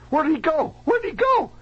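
high, urgent shouted question, rising in pitch; frantic dentist. Retold or imitated. Dentist runs out hunting his patient, frantic high cry. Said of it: "He comes running out".

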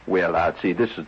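ordinary delivery

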